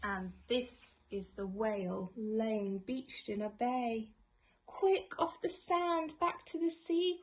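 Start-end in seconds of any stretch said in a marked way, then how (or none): 4.7-7.2 high, loud, hurried plea; panicked snail calling out; urgent cry. A narrator's reading. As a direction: urge the whale back to sea. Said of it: "cried the snail".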